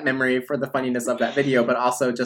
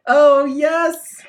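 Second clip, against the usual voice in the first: delighted recognition; 13 semitones higher, 6 dB louder than usual. Real, not acted. Remembering the summer salad.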